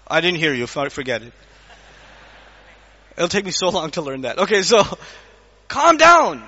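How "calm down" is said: raised, forceful "Calm down"; firm calm down. Shown as a demonstration. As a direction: say "Calm down" louder and higher, firmly. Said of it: "how do you say calm down".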